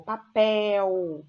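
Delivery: "papel" with a loud, stretched PEL; stress on last syllable. Shown as a demonstration. Teacher showing students the stressed syllable in "papel", loud stress on PEL. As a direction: lean hard on the end of "papel", pa-PEL. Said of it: "olha a sílaba tônica no pel aqui".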